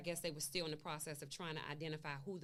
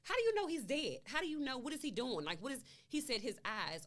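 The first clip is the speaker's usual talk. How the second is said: much higher, louder distraught questions; pleading, upset disbelief; reliving the call, distressed and raised; 8 semitones higher, 6 dB louder than usual. Real, not acted. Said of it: "I'm getting upset with him".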